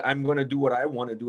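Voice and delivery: ordinary talk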